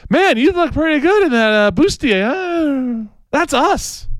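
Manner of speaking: a cartoonish boyfriend nudging his girlfriend toward lingerie; mock boyfriend voice. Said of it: "and says".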